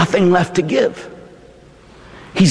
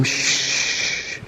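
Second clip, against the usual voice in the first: tender lullaby; 5 semitones lower, much slower than usual. Acted. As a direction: soothe a crying baby. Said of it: "she would rock him".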